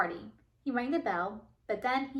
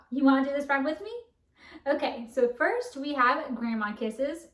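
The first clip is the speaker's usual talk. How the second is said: ordinary talk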